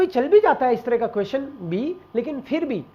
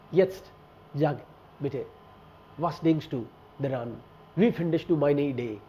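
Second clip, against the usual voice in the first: slow, softer coaxing of a silent partner; gentle coaxing prompt; 8 semitones lower, 6 dB quieter, much slower than usual. Shown as a demonstration. Student gently coaxing a silent exam partner, slow and soft. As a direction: ease the partner into talking, softly. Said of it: "अपने शब्द उसके मुंह में डालने की कोशिश करो".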